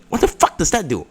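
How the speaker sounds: high, baffled mock shout; retold shouting. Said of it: "like, shouting".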